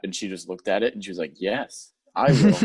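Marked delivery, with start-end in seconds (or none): 1.4-2.6 hammed-up eager yes, last words louder and higher; mock Mina. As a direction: blurt out a keen promise to the bat. Mina answers the bat.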